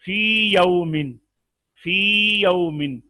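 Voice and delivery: फी drawn out long, then यौमिन separately; long vowel kept. Shown as a demonstration. Teacher showing students an unmerged reading, फी held long and slow. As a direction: hold the "ee" of फी before a clean यौमिन. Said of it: "इसको फौत नहीं होने देना".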